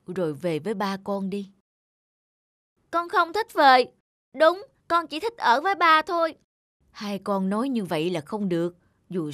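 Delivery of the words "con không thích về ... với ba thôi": put-on higher, louder insistent child voice; protesting child. Children refusing to leave the adoptive father, protesting. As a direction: refuse outright, clinging to dad.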